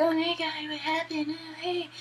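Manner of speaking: high, soft imitated woman; put-on high little voice, as if just coming round; mock dazed greeting. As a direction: squeak out a soft, high hello. Acting out Meryl greeting after torture.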